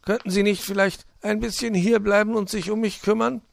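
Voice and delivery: high, plaintive request; pleading. A narrator's reading. Fox asking Puh to stay, pleading in a high voice. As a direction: plead for some looking after.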